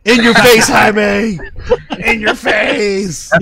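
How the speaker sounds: high, loud taunting shout, last words slow; gloating shout. Real, not acted. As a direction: rub it in Jaime's face. Gloating at Jaime over pizza.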